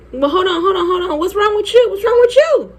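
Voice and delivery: put-on high, insistent girlfriend voice; alarmed friend. Friend coming over in the hall, high-pitched alarm.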